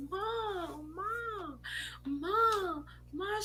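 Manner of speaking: high, pleading calls after her. Retold or imitated. Daughter calling after her walking-away mother, high and pleading. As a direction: beg her to stop walking. Chasing mother in parking lot.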